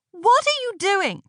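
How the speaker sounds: high, startled outcry; alarmed cry. A narrator's reading. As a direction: cry it out in disgust and fright. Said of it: "cried Aneshka".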